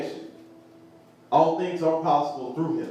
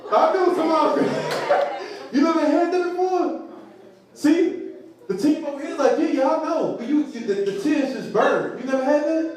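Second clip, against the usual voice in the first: higher, laughing, teasing the crowd; laughing tease; 8 semitones higher, 3 dB louder than usual. Real, not acted. Pastor teasing the congregation about burning tears, laughing. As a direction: poke fun at the ones who deny it.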